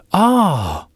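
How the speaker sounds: very high, loud amazed ah; surprised ah. A narrator's reading. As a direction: gasp out a wondering ah. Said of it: "on entendit des".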